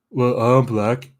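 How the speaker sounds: slow, weighty mock delivery of a film line; heavy, deliberate parody of a character; mock solemn. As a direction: drag it out like a clunky script. Character's line acted out slowly.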